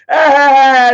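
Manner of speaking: high, loud retold scream; mock scream. Reliving the scream that made his siblings cry, high and loud. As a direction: shriek it the way it came out under the choke.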